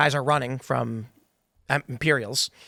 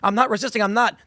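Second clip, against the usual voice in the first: mock-desperate, higher-pitched protest; panicked protest; 6 semitones higher than usual. Citizen facing a robot cop, panicked protest. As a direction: plead innocence in a hurry.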